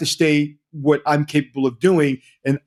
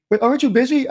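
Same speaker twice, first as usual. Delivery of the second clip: worried client; 10 semitones higher than usual. Retold or imitated. Client's worried question, acted quote.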